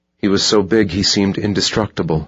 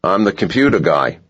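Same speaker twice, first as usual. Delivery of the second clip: put-on South African accent; 4 semitones higher, 3 dB louder than usual. A narrator's reading. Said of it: "in a South African accent".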